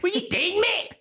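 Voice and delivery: high, startled squeal of disbelief; shocked outburst. Retold or imitated. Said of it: "I was like".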